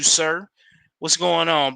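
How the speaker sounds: ordinary talk, nothing marked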